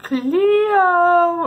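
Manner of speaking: very high, loud eerie sing-song call; eerie horror-movie call. Retold or imitated. Sister calling the dog like in a horror movie, eerie. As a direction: call the name like a ghost in a scary film. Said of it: "And then I hear".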